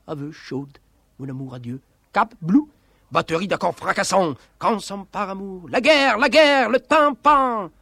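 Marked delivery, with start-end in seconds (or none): 5.7-7.7 high, loud war cry; theatrical shout. Acted. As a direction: cry it out at full theatrical force. Climax of the dramatic recitation. (cri de guerre théâtral)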